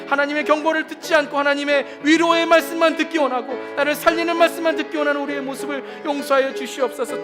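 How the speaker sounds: ordinary talk, nothing marked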